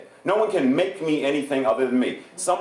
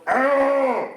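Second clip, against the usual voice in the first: disgusted ugh; 9 semitones higher, 3 dB louder than usual. Shown as a demonstration. Groaning at bad feelings.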